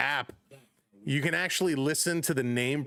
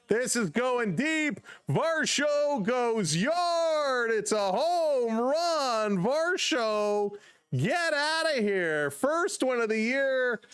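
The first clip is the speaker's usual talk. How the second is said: high, slow, drawn-out play-by-play shout; excited home run call; 7 semitones higher, much slower than usual. Real, not acted. Fan streamer calling Varsho's first home run, thrilled. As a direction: ride it out like a broadcaster calling the homer.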